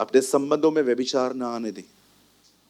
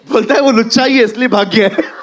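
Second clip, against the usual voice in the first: shouted through laughter, much louder and higher; laughing tease; 8 semitones higher, 9 dB louder than usual. Real, not acted. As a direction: loudly tease the runaway while laughing. Teasing a man who ran off.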